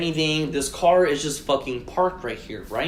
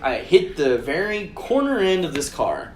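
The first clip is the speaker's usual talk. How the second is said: ordinary delivery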